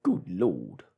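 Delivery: big barman, alarmed; burly barman exclaiming. Acted. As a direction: blurt it in alarm. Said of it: "said the burly barman".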